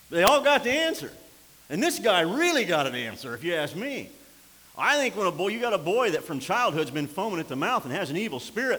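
loud, high, hammering sermon delivery; preaching at full force. Acted. Preacher warning the congregation about returning spirits, full-force preaching. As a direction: preach it like a revival.

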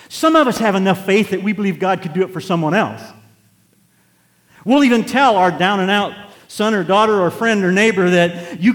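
loud, high, driving sermon voice; full-force preaching. Acted. Preacher rousing the congregation, loud full-force preaching. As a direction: preach it hard to the back pews.

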